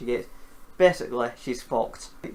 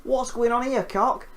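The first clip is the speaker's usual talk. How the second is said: mock surprise; 9 semitones higher, slower than usual. Second Rodan spotting a rival, hammed-up high surprise. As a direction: stretch the whoa out, bug-eyed surprise. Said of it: "It's like".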